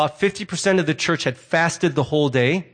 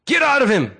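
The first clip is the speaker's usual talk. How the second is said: higher, forceful mock command; commanding exorcist; 6 semitones higher, 3 dB louder than usual. Disciples casting out a demon, commanding. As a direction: order it out sharply.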